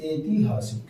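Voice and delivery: ordinary delivery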